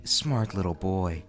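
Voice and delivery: low, soft, slow sultry purr; creepy teacher voice. Acted. Vlogger voices the teacher's creepy praise, low and slow.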